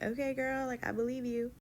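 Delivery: lightly mimicked doubtful agreement; sceptical placating. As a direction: go along with it, unconvinced. Humouring a doctor she doubts.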